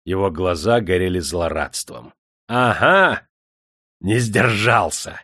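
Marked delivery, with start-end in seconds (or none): 2.5-5.2 raised, emphatic crowing; gloating. A narrator's reading. Master exults over the lad who gave in, gloating. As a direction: crow over a caught victim. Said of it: "ликовал он".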